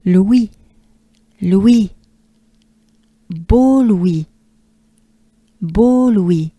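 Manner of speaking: full-voiced French repetition; French drill. Shown as a demonstration. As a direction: repeat "Beau Louis" clearly in French. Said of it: "Take a good breath with me".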